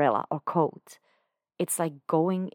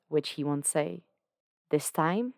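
ordinary delivery, nothing marked

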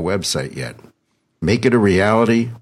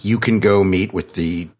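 ordinary talk

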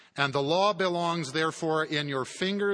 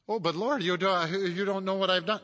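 protesting sinner; 6 semitones higher, faster than usual. Acted.

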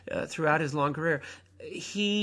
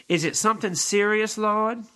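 higher, louder anxious angel voice; Gabriel asking; 7 semitones higher, 6 dB louder than usual. Acted. Gabriel asks the pensive Lord, higher and louder. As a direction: play Gabriel, worried and gentle. Gabriel's line read again.

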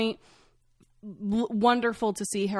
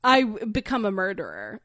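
ordinary talk, nothing marked

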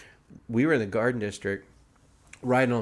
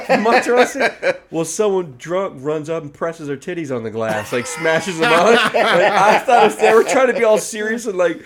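usual speaking voice

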